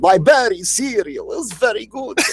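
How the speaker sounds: high, hammed-up cereal-monster voice; monster cereal character. Co-host joking about Count Chocula's shelf, high mascot voice.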